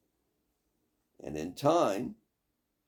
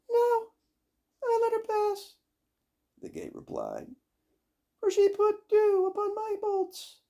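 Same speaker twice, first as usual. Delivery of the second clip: very high loud squeaking gate; creaky gate voice; 6 dB louder than usual. Acted. Gate answering the witch. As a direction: squeal it like a rusty hinge.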